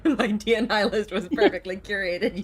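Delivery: shouted retort, half laughing; loud. Acted. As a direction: yell it through laughter. Acted character retort, shouted.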